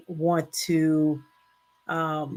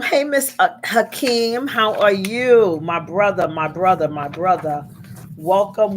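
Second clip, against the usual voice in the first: usual speaking voice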